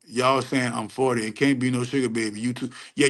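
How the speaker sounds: ordinary delivery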